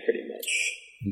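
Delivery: whispered. Real, not acted. Trailing off under his breath.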